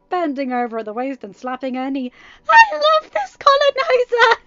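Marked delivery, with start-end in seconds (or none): 2.5-4.4 very high, loud, laughing shriek; much higher, shouting through laughter. Acted. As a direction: cackle it out in pure delight. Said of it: "in between bouts of laughing".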